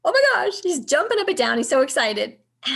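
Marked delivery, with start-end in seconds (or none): 0.0-0.6 high, loud delighted gasp of a phrase; thrilled exclaim. Retold or imitated. Husband seeing the pink test, high loud joy. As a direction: burst out with joy.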